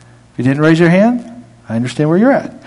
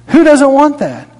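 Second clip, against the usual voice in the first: high, insistent; 9 semitones higher than usual. Acted. Repeated rhetorical question, preached harder.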